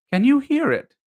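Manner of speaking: sudden discovery; excited question. A narrator's reading. Christopher Robin hears singing, asks high and loud. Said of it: "he asked".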